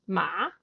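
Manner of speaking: "Ma" sliding upward like an English question; rising tone. Shown as a demonstration. Showing the interviewer the rising tone, "Ma" gliding up. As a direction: say "Ma" with the pitch climbing to the end. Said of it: "You can also go up, like you would do in a question".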